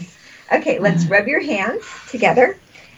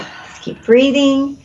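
healer's breathing coaching; 2 semitones higher than usual. Acted. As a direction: coach each breath, then settle.

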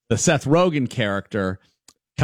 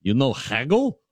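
put-on old-world pickle seller. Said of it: "And he's like".

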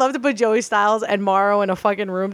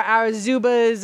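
ordinary talk

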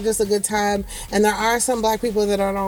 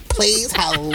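low, stretched "Please hold"; put-on low phone operator voice; Lakeisha; 5 semitones lower than usual. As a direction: drop the pitch like an operator parking a call. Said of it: "That's how Lakeisha does it".

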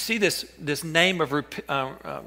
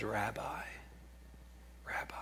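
hushed, breathless whisper of a name; 4 semitones lower, 19 dB quieter than usual. Acted. Judas greeting Jesus before the kiss, soft whisper. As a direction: whisper it like a secret.

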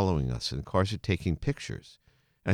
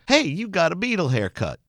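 lightly voiced lunch lady, pitched higher; 9 semitones higher than usual. Lunch lady teases a student about his haircut, light high voice. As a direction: chirp it up high like a cafeteria lady spotting the haircut. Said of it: "the lunch lady said".

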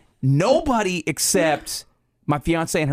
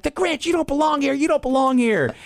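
put-on little kid voice; 10 semitones higher than usual. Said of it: "And he's like".

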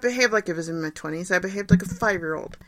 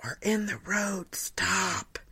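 soft, urgent whispered hush; mom's hushed warning; 5 dB quieter, much slower than usual. Retold or imitated. Mom quietly telling her crying daughter to stop, whispered. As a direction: hush her before anyone sees. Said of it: "And my mom's like".

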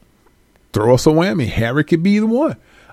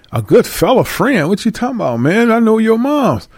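1 incredulous friend; 8 semitones higher than usual. Acted. Tyrone answers Brad in skit.

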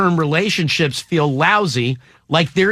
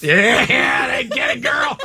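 high, happy shout; excited cheer; 8 semitones higher, slower than usual. Real, not acted. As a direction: cheer her on. Cheering a friend's right answer.

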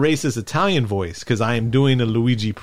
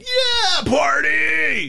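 squealing high cheer, shouted; mock party cheer; much slower than usual. Friends at a party who skipped the show, mock whooping cheer.

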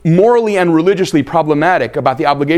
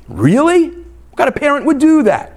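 incredulous; 10 semitones higher than usual. Retold or imitated. Incredulous rhetorical question.

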